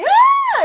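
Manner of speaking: imitating a baby's shrill scream, very high and loud. Aunt imitating the frightened baby nephew, shrill scream. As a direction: mimic the startled baby shrieking. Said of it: "Aí meu sobrinho fez assim".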